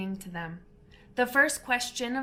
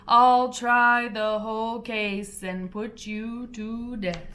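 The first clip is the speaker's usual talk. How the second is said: menacing cat; 5 dB louder, slower than usual. Acted. Fury threatens the mouse.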